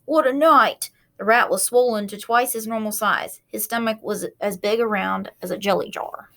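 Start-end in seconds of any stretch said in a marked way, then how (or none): 0.1-0.9 husky, loud exclamation; a husky worn-out cry. A narrator's reading. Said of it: "he said in a husky voice".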